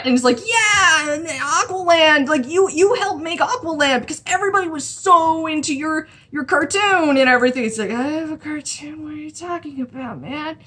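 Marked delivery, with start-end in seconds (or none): 0.5-7.6 high-pitched mock starstruck fan; gushing, higher fan voice; imitated fanboy gushing. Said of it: "he freaks out". 7.9-10.6 soft, higher baffled reply; hushed, puzzled aside in character; mock bewilderment. Aquaman's baffled reply to the fan.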